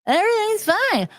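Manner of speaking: high, slow, soothing therapist impression; mock a dismissive, chirpy therapist; hammed-up cheerful shrink voice. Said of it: "the fucking therapist was like".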